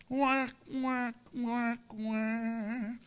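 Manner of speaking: drawn-out, level wah-wah noise; comic failure sound, held on one note; mocking wah-wah. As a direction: imitate a sad trombone deflating the point. Mocking whine after blaming the sick.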